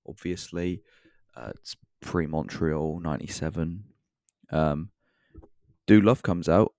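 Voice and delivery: ordinary talk, nothing marked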